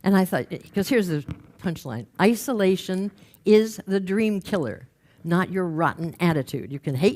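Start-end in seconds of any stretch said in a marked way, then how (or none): none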